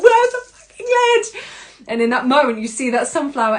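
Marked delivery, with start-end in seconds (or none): 0.0-1.3 high, loud freak-out shout; hammed-up exasperated scream; frantic mock yell. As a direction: yell it as if lost in a storm. Acting out frantic compost lid search.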